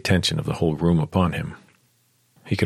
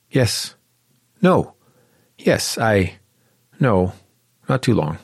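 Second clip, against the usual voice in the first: stammering; 4 semitones higher, slower than usual. Acted. Clara meeting Xander, stammering. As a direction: fumble for words. Confused flustered answers.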